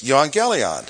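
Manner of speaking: evangelion as "yoo-ahn-GHEL-ee-on"; Greek pronunciation. Shown as a demonstration. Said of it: "Sounds a little different".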